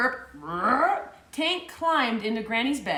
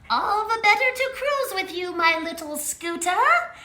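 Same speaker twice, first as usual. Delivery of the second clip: put-on high, creaking old granny voice; creaky fake granny; 9 semitones higher than usual. Tank in disguise answers Little Red, high creaky granny voice. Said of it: "creaked Tank".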